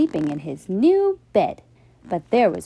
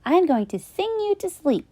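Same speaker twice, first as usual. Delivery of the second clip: put-on cheerful little boy; 10 semitones higher, faster than usual. Flynn voice.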